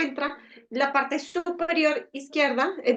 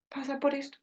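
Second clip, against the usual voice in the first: usual speaking voice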